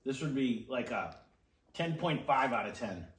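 speaking between bites; talking while chewing. Real, not acted. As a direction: mouth full of pizza. Seen 0: Speaker unclear; young man appears chewing, then bites pizza while talking.

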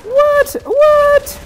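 loud, very high squealed questions; falsetto disbelief. Real, not acted. Player shocked in the game, falsetto disbelief. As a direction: squeal it twice, far up high.

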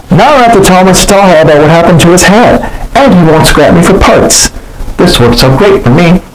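high, pleased plane voice; relieved J J. Acted. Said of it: "J J said".

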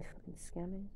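ordinary talk, nothing marked

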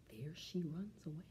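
put-on fast hushed whisper to the kids; storyteller whisper. A narrator's reading. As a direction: lean in and whisper it quickly. Aside to listening children.

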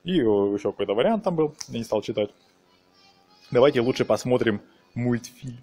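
ordinary talk

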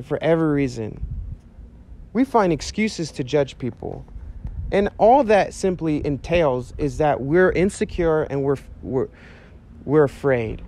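ordinary talk

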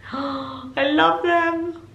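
slow gasping delight; adoring. Real, not acted. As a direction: melt over them. Adoring the gifts.

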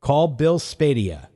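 slow, deliberate command to a phone. Real, not acted. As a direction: spell it out slowly for voice recognition. Speaking a command to Siri.

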